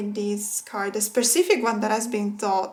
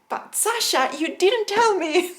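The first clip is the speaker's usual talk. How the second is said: moved high reproach; 8 semitones higher than usual. Real, not acted. Playful reproach to the gift's creator.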